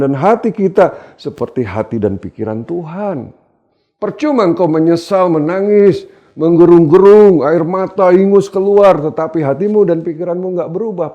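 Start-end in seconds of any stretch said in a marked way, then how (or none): 4.0-11.1 loud, high rebuke; forceful, raised-voice exhortation; full-force preaching. Real, not acted. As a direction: hammer out each phrase from the pulpit.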